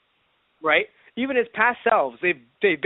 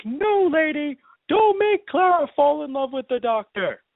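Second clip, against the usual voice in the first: higher, slower pleading to the screen; nervous plea; 8 semitones higher, slower than usual. Retold or imitated. Worried fan pleading with the lady on screen. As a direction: beg the character not to do it. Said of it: "I got so nervous 'cause I'm like".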